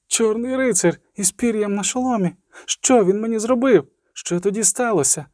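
put-on high-pitched child reliving a terror; frightened girl. A narrator's reading.

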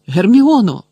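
high, loud call of the name; alarmed cry. A narrator's reading. Ron rushes to Hermione, alarmed shout. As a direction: cry out to an injured friend.

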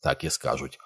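ordinary delivery, nothing marked

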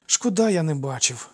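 put-on a touch of childish disappointment; sniffly regret. A narrator's reading. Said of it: "хлюпнув носом Алкід".

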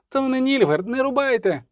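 high, pleading cry of protest; alarmed call, pitched well up; urgent shout. A narrator's reading. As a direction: cry out to halt them. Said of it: "крикнув Любисток".

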